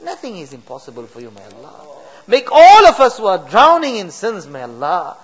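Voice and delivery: usual speaking voice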